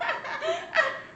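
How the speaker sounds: high, soft laugh; mocking laugh. Real, not acted. Co-host laughing at the wife's empty threat, high soft laugh.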